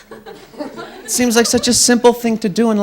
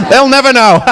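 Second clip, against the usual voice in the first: put-on gleeful drunk, pitched up and loud; Michelangelo; 6 semitones higher, 7 dB louder than usual. Drunk painter gloating over his chapel prank, loud tipsy glee. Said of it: "walked out of there all drunk".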